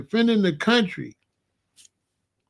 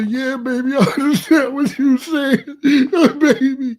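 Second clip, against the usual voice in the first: high, hammed-up blubbering; badly faked sobbing with laughter in it; mock crying; 6 semitones higher, 4 dB louder than usual. Man mocking a husband crying with his queen, mock crying. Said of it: "I'm on the bed crying with her".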